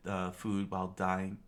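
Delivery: ordinary delivery, nothing marked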